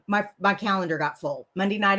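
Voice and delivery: ordinary talk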